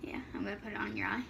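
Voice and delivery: fast unvoiced whisper. Real, not acted. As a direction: whisper it quickly, teasing. Whispers a teasing threat.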